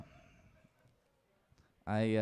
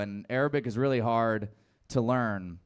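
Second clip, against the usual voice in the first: usual speaking voice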